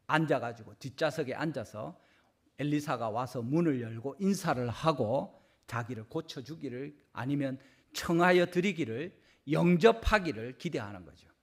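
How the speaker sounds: usual speaking voice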